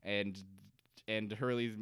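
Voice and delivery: ordinary talk, nothing marked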